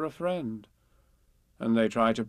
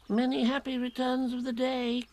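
high, thin little animal voice; Piglet; 12 semitones higher than usual. A narrator's reading. Piglet wishes Eeyore happy birthday, high and piping. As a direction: pipe it up high on a steady note. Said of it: "said Piglet".

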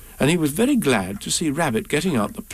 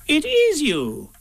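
very high, slow welcoming voice; Rabbit; slower than usual. A narrator's reading. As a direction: greet a friend in a high creature voice. Rabbit greets Pooh.

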